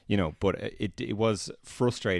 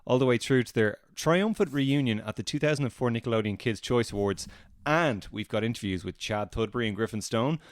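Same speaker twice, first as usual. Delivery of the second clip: bright, upbeat show host voice; podcast host; 5 semitones higher than usual. Acted. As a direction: announce it like a radio host. Fake podcast intro.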